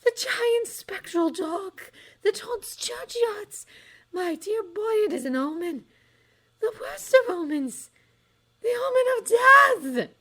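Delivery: high slow seer rising to a loud shout; the seer swelling into a shout of doom; building doom prophecy. A narrator's reading. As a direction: build from dread to a shrieked verdict. Trelawney proclaims the omen.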